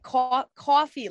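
coffee with a stretched New York aw, high and loud. Shown as a demonstration. Showing how New Jersey folks say coffee, mock New York accent. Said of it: "I can't do it".

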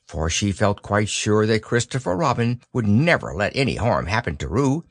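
usual speaking voice

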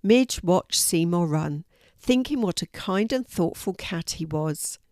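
usual speaking voice

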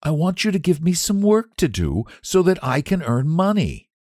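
put-on high, earnest child's voice; a small boy asking for work; little boy asking. Boy asking the squire for work, earnest.

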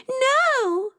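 very high-pitched cry of protest; dismayed exclamation. A narrator's reading. Rosie protesting at losing the room, dismayed exclamation. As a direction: wail it high, without extra volume. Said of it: "Rosie exclaimed".

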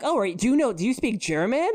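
friendly official voice. Retold or imitated. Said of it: "And he's like".

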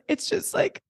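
high, hushed imitation of her friend; breathy high mock-whisper of a tearful friend; imitating Clayton. S0 mimicking Clayton overcome in the lobby, high and hushed. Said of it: "she goes".

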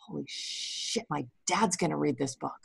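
retold in a near whisper; hushed, dismayed husband impression; husband's hushed dread. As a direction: drop to a low, whispery aside. Husband's alarmed aside, retold.

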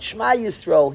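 normal talking voice

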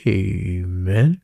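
stretched playful amen, rising at the end; drawn-out amen. Retold or imitated. Teacher cueing the class for the prayer, drawn-out amen. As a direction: lead the class in a long, questioning amen. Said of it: "I would always be like".

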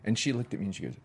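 ordinary talk

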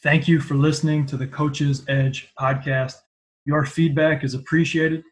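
loud, upbeat host sign-off; energetic outro read; podcast outro. Acted. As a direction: close the show like a radio host.

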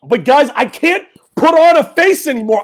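ordinary talk, nothing marked

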